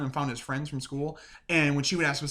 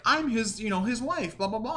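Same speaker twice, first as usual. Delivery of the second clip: high put-on girly wife voice; mock wifely introduction; pretend young wife; 8 semitones higher than usual. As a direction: pose as his wife, pitched up. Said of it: "My girl shows up in a different outfit".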